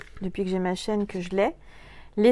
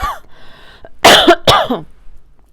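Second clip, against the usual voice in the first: long, loud coughing fit; real, repeated loud coughs; throat-clearing cough; 10 semitones higher, 13 dB louder than usual. Real, not acted. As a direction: cough hard at a frog in the throat. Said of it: "j'ai un chat dans la gorge".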